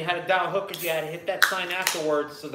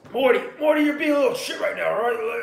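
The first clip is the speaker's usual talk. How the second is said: lightly voiced, louder scolding Rick; Rick impression; 7 semitones higher, 6 dB louder than usual. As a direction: scold Morty like a grumpy mad scientist. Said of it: "So do your Rick".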